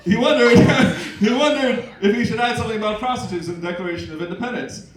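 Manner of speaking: ordinary delivery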